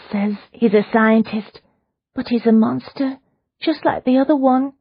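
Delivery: half-hearted frightened hush; terrified whisper. Acted. Prisoner confides her fear to Leona, mildly hushed. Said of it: "in a terrified whisper".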